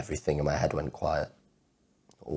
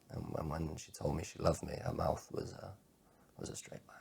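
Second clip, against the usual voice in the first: much softer, sinking almost to nothing; quiet hurt; 12 dB quieter than usual. Acted. Man recalling her love fading, hushed and hurt. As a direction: let the words fade away under the hurt.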